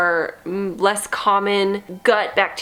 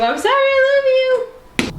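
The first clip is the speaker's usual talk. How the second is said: high, louder sing-song apology to the cats; 5 dB louder than usual. Real, not acted. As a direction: squeal a sorry to the kitties. Apologising to cats she tricked.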